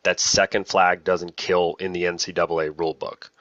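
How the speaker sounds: ordinary talk, nothing marked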